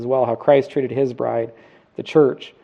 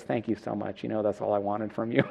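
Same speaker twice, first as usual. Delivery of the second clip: grateful wife; 3 semitones lower, 11 dB quieter than usual. Retold or imitated. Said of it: "takes his hand and goes".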